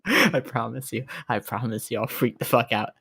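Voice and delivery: ordinary talk, nothing marked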